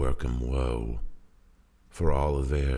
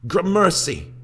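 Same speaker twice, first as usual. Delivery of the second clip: joyful cry; 9 dB louder than usual. Acted. Crew rejoices at the sail.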